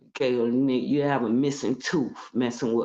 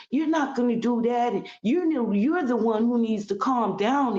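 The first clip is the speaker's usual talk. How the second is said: higher, lightly mocking sister-in-law; mock her scolding, pitched up; imitating Malia; 9 semitones higher than usual. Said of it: "spoke to me".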